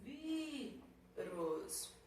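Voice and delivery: Vírus with VÍ held long, rus separate; stressed syllable drawn out. Shown as a demonstration. Teacher marking the accent, VÍ drawn out. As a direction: stretch VÍ, then drop rus. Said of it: "Então, vamos acentuá-los".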